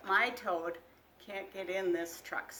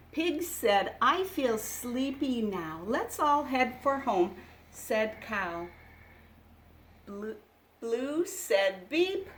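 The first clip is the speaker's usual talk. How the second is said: put-on louder, lively read-aloud for small children; picture book reading; 7 dB louder, slower than usual. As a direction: read it like a bedtime storybook.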